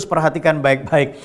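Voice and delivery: ordinary talk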